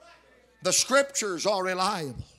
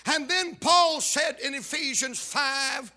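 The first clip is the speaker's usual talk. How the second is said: ordinary delivery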